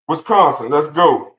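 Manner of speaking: loud, high hype call for the next state; excited shout to the viewers. Real, not acted. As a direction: fire up the chat, big and loud. Hyping next state on stream.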